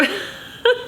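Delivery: high, tearful laugh; embarrassed laugh through real tears; emotional laugh. Real, not acted. Vlogger laughing at her own tears, emotional. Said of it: "emotional about this".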